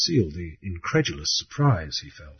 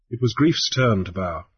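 ordinary delivery

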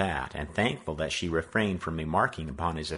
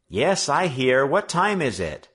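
higher, louder call through a door; sleepy boy calling out; 7 semitones higher, 7 dB louder than usual. A narrator's reading. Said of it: "he cried".

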